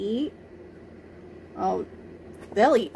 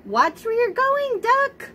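high, scolding hen voice; cross chicken; 13 semitones higher, 3 dB louder than usual. A narrator's reading. Chicken scolding Duck for nearly running her over, high voiced. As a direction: scold him like a fussy hen. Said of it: "what she thought was".

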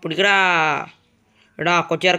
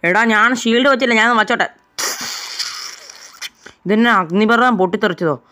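loud, high battle yelling; shouting; 5 semitones higher, 4 dB louder than usual. Acted. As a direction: yell over the chaos.

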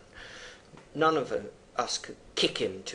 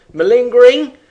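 shouted scornful question, much higher; mocking shout; 11 semitones higher, 21 dB louder than usual. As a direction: hurl the accusation at full volume. Poem quote, doctor's accusation.

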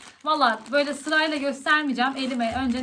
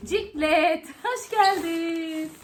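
talking to a pet; 4 semitones higher, much slower than usual. Real, not acted. Greets her dog on return.

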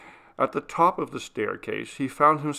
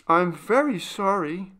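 faltering apology; 8 semitones higher, 5 dB louder than usual. Acted. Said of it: "faltered Maria, weeping silently".

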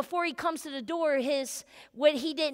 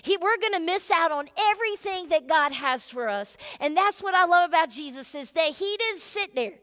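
normal talking voice

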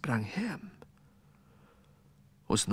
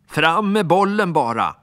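loud, higher shouted order; angry demand; 8 semitones higher, 8 dB louder than usual. Acted. Alfons demanding the ball from the boy, loud and angry. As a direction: bark it at him. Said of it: "grälade Alfons".

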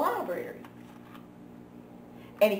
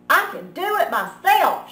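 higher, louder proud shout; 8 semitones higher, 7 dB louder than usual. Acted. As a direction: snap it out stubbornly. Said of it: "shouted Froggy".

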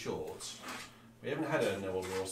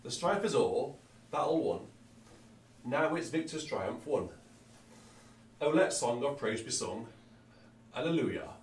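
put-on a lightly done Yorkshire accent on the hymn words; my best Yorkshire accent; 3 semitones higher, 3 dB louder than usual. An organist reading a hymn to viewers in a light Yorkshire accent. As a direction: read the hymn in a mild, hammed-up Yorkshire accent. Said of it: "That's my best Yorkshire accent".

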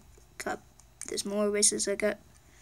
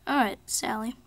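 normal talking voice

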